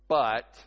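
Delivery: loud, isolated "But" before a condition; parent's pointed "But". Acted. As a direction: land hard on "But" like a parent adding a catch. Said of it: "then they go".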